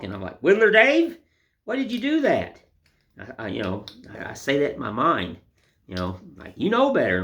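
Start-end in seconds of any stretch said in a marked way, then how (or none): 0.4-2.6 high, loud scolding of himself by name; stern self-scolding. Retold or imitated. Carver giving himself a stern talk over a mistake, high loud scolding. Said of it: "I have to have a stern talk". 6.6-7.2 higher, louder scolding voice; stern rebuke. Retold or imitated. Carver scolding himself for a carving mistake, high stern rebuke. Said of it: "I have to have a stern talk".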